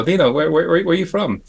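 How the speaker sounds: lightly lisped stammering question; Dick Smith lisp. Retold or imitated. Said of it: "He had a little bit of a lisp".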